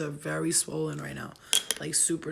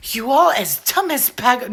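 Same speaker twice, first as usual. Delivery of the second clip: very loud, higher-pitched theatrical delivery; over-the-top acting; 5 semitones higher, 13 dB louder than usual. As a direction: belt it out like a ham actor. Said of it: "I will try every different kind of acting".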